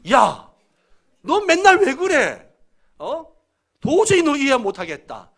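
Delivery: loud, high-pitched angry parent; 유 메시지 꾸중. Acted. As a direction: yell at the child in exasperation. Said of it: "이런 식으로 이야기를 하잖아요". (버럭 소리치며 아이를 나무라는 말투)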